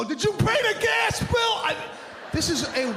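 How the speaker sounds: mock nagging question. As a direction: blurt it like a nagging spouse. Mock mid-workout chat with wife.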